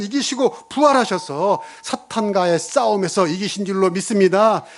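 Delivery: driving revival-style declaration; impassioned preaching. Real, not acted. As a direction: proclaim it fervently to the congregation. Preaches Christ's victory with force.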